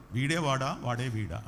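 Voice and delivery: a hint of a put-on low preaching voice; mock preacher bass. Preacher mocking pastors who change voice, mild low mimicry. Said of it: "బేస్లోకి వెళ్ళిపోయి".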